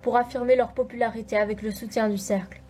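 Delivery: dramatic next-episode voiceover; trailer narration. Acted. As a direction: tease the next episode with suspense.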